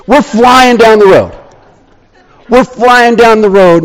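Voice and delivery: normal talking voice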